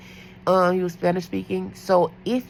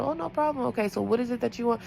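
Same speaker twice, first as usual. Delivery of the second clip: sweet nurse voice; 6 semitones higher, 4 dB quieter, faster than usual. Retold or imitated. Nurse retells her sweet reply.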